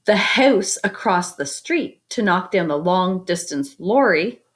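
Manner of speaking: lively picture-book reading; story narration. A narrator's reading. Teacher reading a picture book to the class, lively storytelling. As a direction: read it out to the kids with wonder.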